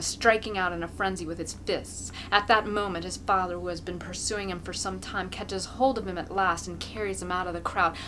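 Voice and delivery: ordinary delivery, nothing marked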